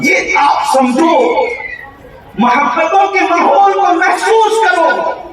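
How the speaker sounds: high-pitched, ringing exhortation; full-force preaching. Real, not acted. Preacher teaching the crowd respect for parents, full-force preaching. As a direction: drive the teaching home at a sustained high pitch.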